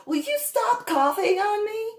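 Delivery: high-pitched imitation of the irritated stranger; lightly voiced snippy stranger; mock annoyed woman. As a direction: whine it like the woman complaining. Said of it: "this female, she just".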